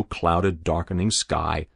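usual speaking voice

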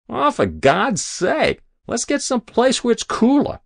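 very high, whining complaint; fed-up lout. A narrator's reading. Hero complaining to the officials, fed up. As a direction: moan it in a high, exasperated voice.

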